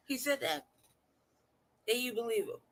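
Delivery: high-pitched imitation of a comeback; mock incredulity. Host imitating the man's reply, mock incredulous.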